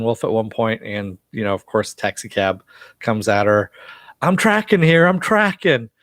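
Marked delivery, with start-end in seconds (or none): 4.2-5.9 high, loud indignant yell in a famous movie line; classic movie yell. Retold or imitated. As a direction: shout it like the iconic street-crossing movie line. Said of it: "we have some really good classic lines for movies".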